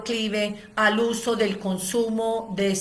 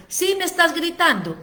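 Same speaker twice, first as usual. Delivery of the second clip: mock insisting child; 6 semitones higher than usual. Acted quarrel, child insists.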